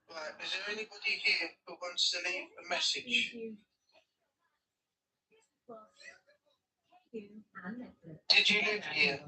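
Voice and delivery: soft, hushed calls to spirits; quiet careful questions into the dark; talking to a ghost. Real, not acted. Calling out to spirits, ghost hunt.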